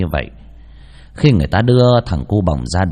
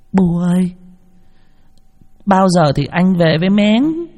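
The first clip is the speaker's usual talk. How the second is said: put-on high, slow, innocent child's question; little girl; 9 semitones higher than usual. Grieving child asks mother. (giọng bé gái ngây thơ hỏi mẹ)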